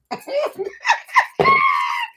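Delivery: very high, squealing laugh; the surprise laugh she promised her babies; high shrieking laugh. Real, not acted. Surprising her viewers with a big laugh, high shrieking laugh.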